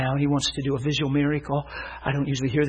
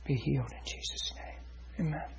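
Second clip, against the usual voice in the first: hushed prayer; 13 dB quieter than usual. Real, not acted. Preacher prays healing over Ron, hushed prayer. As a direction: end the prayer almost under your breath.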